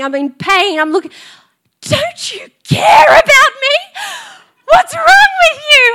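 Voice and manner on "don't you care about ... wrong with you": much higher, louder, slow crying yell; crying shout. Retold or imitated. Hurt mum on the floor yelling at her kids, crying. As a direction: wail at them through the tears. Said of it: "ugly crying".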